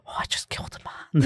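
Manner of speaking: whispered mock realization; soft, high, horrified hush; dawning horror. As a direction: whisper it as it sinks in. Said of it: "slowly starting to realize what you've done".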